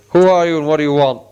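loud, fast and gruff, a big man's voice; gruff demand. A narrator's reading. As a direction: bark it at the strangers. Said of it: "he asked gruffly".

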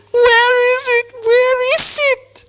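very high, drawn-out creature wail; crying Gollum. A narrator's reading. Gollum searches his island in vain, wailing in distress. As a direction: wail it slowly in a piercing little creature voice. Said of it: "heard him crying".

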